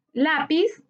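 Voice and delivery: usual speaking voice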